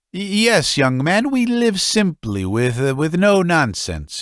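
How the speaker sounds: father defending his simple life to his son, high and fretful; muttering old father. Acted. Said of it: "he went on muttering".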